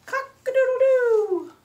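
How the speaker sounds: very high, loud crowing; rooster's crow. A narrator's reading. Storyteller voices a rooster for children, loud crow. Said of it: "crowed the rooster".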